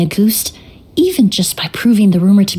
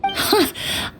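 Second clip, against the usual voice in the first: soft laugh; 11 semitones higher, 8 dB quieter than usual. Acted. Emily laughs at Paimon's offer.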